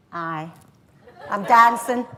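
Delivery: put-on cocky Glaswegian girl giving in; gallus Glaswegian accent. Girl accepts dance from guy, gallus Glaswegian accent. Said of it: "I go into the accent".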